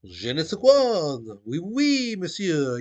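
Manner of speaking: loud, high, exaggerated French accent; fake French accent. Shown as a demonstration. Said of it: "French accent".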